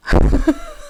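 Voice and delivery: high squealing laugh; burst of laughter. Real, not acted. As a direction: laugh out in delight. Laughing at phone grab.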